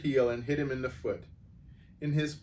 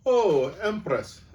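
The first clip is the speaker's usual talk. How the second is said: put-on loud, high Scottish-accented call; 7 semitones higher, 8 dB louder, slower than usual. Reader voicing a crowd heckler by mistake, Scottish accent. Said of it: "I just went a Scottish accent there".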